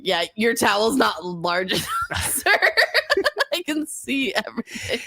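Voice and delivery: a shouted then squeaky mocking dad voice; mock Cody voice. Mocking a friend's dad in the steam room. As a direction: ham up the pushy steam room guy.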